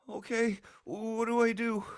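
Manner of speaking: high small uncertain voice; a nervous kid ready to trust; timid child. Acted. Rabbit child asking the player for help, high and timid. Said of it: "he's gonna".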